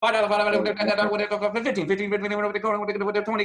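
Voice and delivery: fast loud auctioneer chant. Pretend. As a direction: rattle off bids like an auctioneer. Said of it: "I'm like an auctioneer over there".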